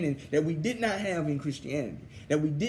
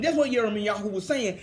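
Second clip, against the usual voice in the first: ordinary delivery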